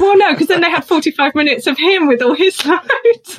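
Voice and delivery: high-pitched comic objection; joking protest. Real, not acted. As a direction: protest playfully, pitched right up. Joking protest amid shared laughter.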